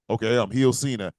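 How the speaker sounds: usual speaking voice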